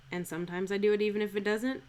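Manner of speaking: ordinary talk, nothing marked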